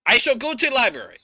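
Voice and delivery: high, loud mock-formal proclamation; hammed-up orc shout. Orc lady declaring a library trip, high acted shout. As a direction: belt it out as a grand announcement.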